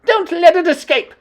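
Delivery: high, loud furious commands; furious witch queen. Acted. Grand High Witch ordering the hunt, furious. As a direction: demand the child's death.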